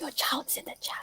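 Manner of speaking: breathy teasing whisper to the group. Real, not acted. Whispering a tease to the group about the baby. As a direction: lean in and whisper the joke.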